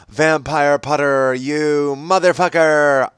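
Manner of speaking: slow, drawn-out hammed-up yell; mock yell. A narrator's reading. Ebony yelling at Vampire in class, slow mock yell. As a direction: stretch the curse out, lightly angry. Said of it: "I yelled".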